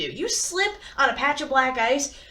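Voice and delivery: ordinary talk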